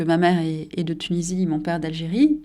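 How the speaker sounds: ordinary delivery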